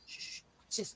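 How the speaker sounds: hushed, voiceless stage whisper; conspiratorial whisper. Retold or imitated. Grandpa letting grandkid in on the prank, conspiratorial whisper.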